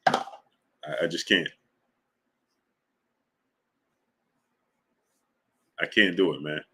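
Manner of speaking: low, soft and defeated; worn out by the argument; resigned. Real, not acted. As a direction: trail off, exhausted. Gives up on argument.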